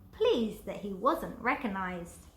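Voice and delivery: ordinary delivery, nothing marked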